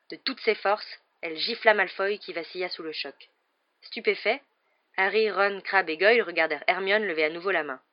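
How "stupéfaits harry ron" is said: ordinary delivery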